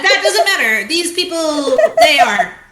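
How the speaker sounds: real giggles breaking up a defence; laughing. Real, not acted. Player admitting Witch and Light employ her, cracking up.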